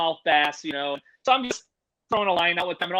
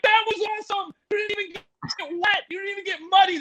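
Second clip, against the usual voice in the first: put-on much higher, thrilled kid voices; excited nephews; 13 semitones higher than usual. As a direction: play the awestruck little nephews, high. Nephews cheering the catch.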